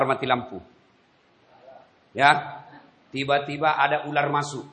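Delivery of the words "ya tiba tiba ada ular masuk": usual speaking voice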